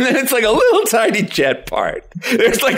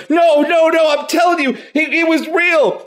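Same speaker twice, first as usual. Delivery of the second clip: desperate protest; 9 semitones higher than usual. Acted. Man taken away in a straitjacket insisting, hammed-up desperate protest. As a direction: protest as you're dragged off. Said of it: "as you're being taken away and handcuffed".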